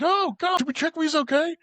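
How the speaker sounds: high, worried imitation; hammed-up high-pitched worry; mock alarm. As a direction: act shocked and concerned, pitch way up. Acting alarm after car accident.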